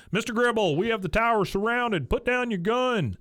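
megaphone cop. Retold or imitated. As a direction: call it out like a cop on a PA. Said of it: "we hear the cops over the megaphone".

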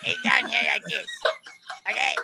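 throaty cartoon demonstration voice; Cartman. Shown as a demonstration. Showing where the voice sits, Cartman impression. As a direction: stay in the squashed cartoon voice. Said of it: "Cartman's more deeper".

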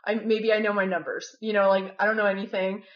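ordinary talk

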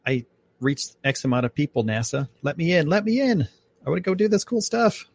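high, eager begging; mock pleading to NASA. Podcaster imagines pleading with NASA, high and eager. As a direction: beg to be let in like a kid. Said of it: "Be like".

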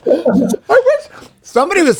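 laughing. Real, not acted.

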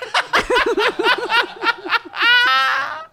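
big shrieking laugh; loud laughing shout. Real, not acted.

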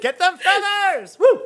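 high, cheering shout from the sidelines; rally them on like a fan. Acted. Cece cheers Kieran toward the feathers, high excited shout.

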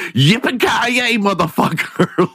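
high mock-yelled Yippee-ki-yay, motherfucker; mock fan yell. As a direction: hoot it like an obnoxious fan at the star. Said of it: "people are going".

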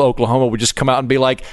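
ordinary delivery

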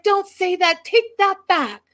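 high, loud frantic protest; mock panicked yell. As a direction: yell it high, imitating someone freaking out. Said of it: "Still screaming".

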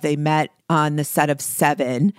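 ordinary talk, nothing marked

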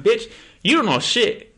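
loud, high-pitched mock outburst; cocky retort. Acted retort to his girlfriend.

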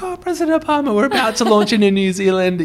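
high put-on nervous voice; squeaky fake formal; mock flustered. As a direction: ham up your nervous reply. Mock flustered reply to president.

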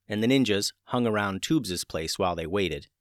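ordinary delivery

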